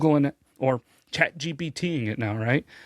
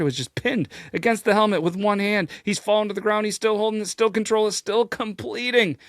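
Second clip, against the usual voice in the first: high, louder running commentary on a big catch; excited play-by-play; 10 semitones higher, 4 dB louder than usual. Acted. Host reliving the helmet catch for viewers, excited play-by-play. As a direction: call it like the play is happening live.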